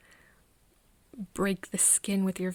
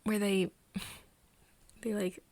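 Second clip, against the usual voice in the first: ordinary talk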